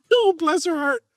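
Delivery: very high, fond coo; mock cooing. Speaker tells of a little old lady, high cooing. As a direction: coo it high, as over someone sweet.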